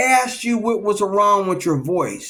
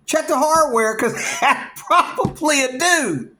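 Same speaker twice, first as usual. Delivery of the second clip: laughing; 7 semitones higher than usual. Real, not acted. Laughs while warning.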